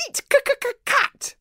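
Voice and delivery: very high, squeaky creature stutter; high-pitched stuttering little dragon; stammering baby dragon. A narrator's reading. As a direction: stammer it out as a tiny demanding dragon. Baby dragon threatens cat.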